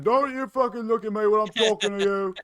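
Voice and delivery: high mock scolding in a put-on voice; imitated skit yelling. Co-host demonstrates the skit man over babbling kids, mock yell. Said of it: "as you're hearing".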